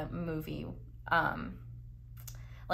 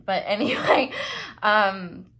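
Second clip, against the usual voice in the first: laughing; 2 semitones higher, 8 dB louder than usual. Real, not acted. Laughs off the burping.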